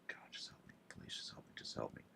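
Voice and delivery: breathless, hushed plea to God; pleading whisper to God to keep calm; desperate whisper. Retold or imitated. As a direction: whisper it like a silent prayer under your breath. Desperate whispered prayer retold.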